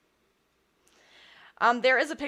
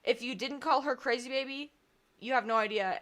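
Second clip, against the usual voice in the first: ordinary talk, nothing marked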